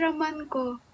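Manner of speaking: usual speaking voice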